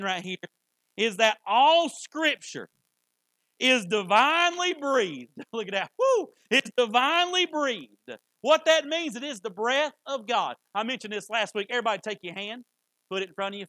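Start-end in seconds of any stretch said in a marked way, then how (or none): 1.0-5.3 loud, higher, drawn-out preaching; emphatic preaching. Real, not acted. Preacher building emphasis on verse. 6.0-6.3 high, loud "woo"; excited whoop. Real, not acted. Preacher excited by the verse. 6.5-10.6 high, loud proclamation; fervent preaching. Real, not acted.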